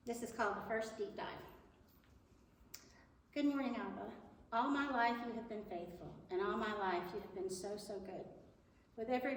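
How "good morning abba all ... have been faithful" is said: usual speaking voice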